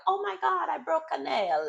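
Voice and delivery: high, prissy mock whine about a broken nail; hammed-up high voice mocking a fussy contestant.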